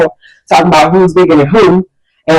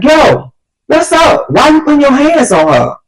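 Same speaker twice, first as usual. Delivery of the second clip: put-on raised-pitch, challenging boyfriend voice; confrontational boyfriend; 10 semitones higher than usual. Boyfriend confronts the boy touching his girl, challenging. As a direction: imitate a boyfriend stepping in.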